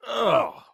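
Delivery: loud, high groan of disgust; disgusted ugh. Acted. Finding a corpse in the bathroom, loud groan of disgust. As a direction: recoil at what's in the room. Sees the body in the tub.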